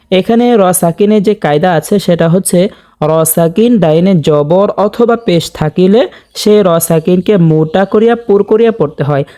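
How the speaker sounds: ordinary talk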